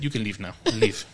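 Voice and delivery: low, hushed, Russian-sounding dismissal; mock foreign accent, quiet and curt. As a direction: imitate her with a rough Russian-sounding accent. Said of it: "In her, in her Russian accent".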